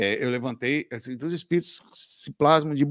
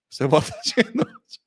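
rindo; 9 semitones higher, 3 dB louder than usual. Real, not acted. Mocking the man with the doll.

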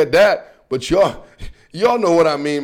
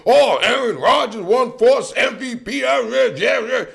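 lightly raised, dismissive friend voice; mocking a friend; 4 semitones higher than usual. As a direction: brush him off like a know-it-all buddy. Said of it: "I'm like".

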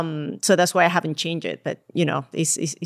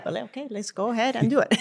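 laughing it out; 5 semitones higher, faster than usual. Retold or imitated. Telling her friend she agreed to qualify for Boston, laughing. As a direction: laugh through the yes, half believing it. Retelling agreeing to crazy plan.

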